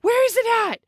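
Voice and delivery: high frantic mock panic; panicked. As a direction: beg to know where it is, scared stiff. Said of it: "And he goes".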